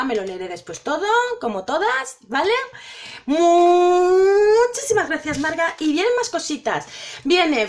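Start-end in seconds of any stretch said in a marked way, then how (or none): 3.3-5.8 slow, loud, drawn-out thanks; deeply moved, slow gratitude; heartfelt thanks. Real, not acted. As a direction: stretch the thank-you with real feeling.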